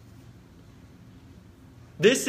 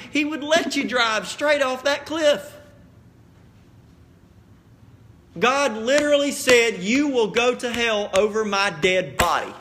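fiery preaching; 3 semitones higher, 4 dB louder than usual. Acted. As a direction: lean into the altar call. Preacher raises the call.

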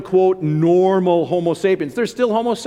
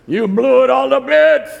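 a higher, louder movie-hero line delivered with a hint of Charlton Heston; 5 semitones higher, 4 dB louder than usual. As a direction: belt it out like the hero in the old Planet of the Apes. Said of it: "Charlton Heston".

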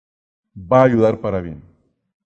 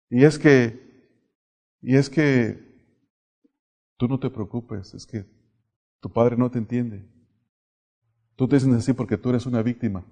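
soothing teacher; 2 semitones lower, 5 dB quieter than usual. Retold or imitated. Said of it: "un profesor se me acercó".

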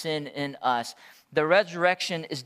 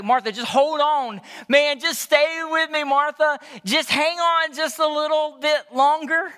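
urgent encouragement; 6 semitones higher, 5 dB louder than usual. Acted. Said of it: "he's like".

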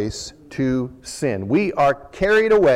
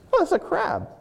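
surprised. Retold or imitated. Excited crab catching discovery.

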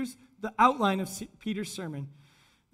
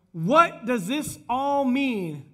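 slow, drawn-out, louder puzzled question; great perplexity; 3 semitones higher, 5 dB louder, much slower than usual. Acted. Crowd asking one another in amazement, slowly perplexed. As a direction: stretch it out in bafflement. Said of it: "with great perplexity".

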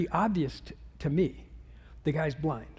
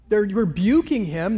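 ordinary talk, nothing marked

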